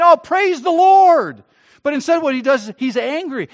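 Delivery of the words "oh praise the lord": slow, high and loud, a hammed-up "praise the Lord"; mock joyful son. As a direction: play the son who should rejoice, overdone.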